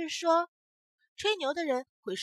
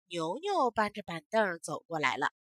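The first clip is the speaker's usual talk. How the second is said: usual speaking voice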